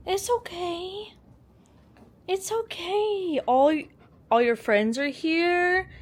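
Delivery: high, slow coo to a scared pet; soothing. Real, not acted.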